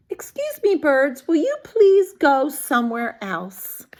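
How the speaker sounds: careful polite request; polite Elephant. Acted. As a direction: ask them nicely. Elephant politely asks birds.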